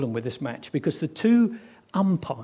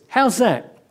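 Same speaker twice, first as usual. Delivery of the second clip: loud, higher appeal to the umpire; cricket appeal; 5 semitones higher, 6 dB louder than usual. Acted. Bowler appealing to the umpire for a wicket, calling out.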